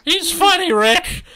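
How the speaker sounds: very high, very loud squeal; over-the-top squealed delivery; hammed-up exclamation. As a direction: shout it way up high for laughs. Reader hams up the retry.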